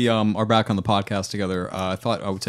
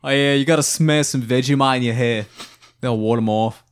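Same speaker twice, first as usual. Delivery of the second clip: mock Australian. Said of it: "I'm sorry, I'm not Australian".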